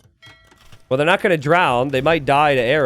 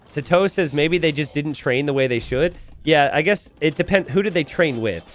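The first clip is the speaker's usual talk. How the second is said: usual speaking voice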